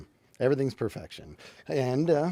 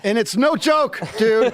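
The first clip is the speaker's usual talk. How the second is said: loud and rattled; 6 semitones higher, 5 dB louder, slower than usual. Real, not acted. Shock collar just went off.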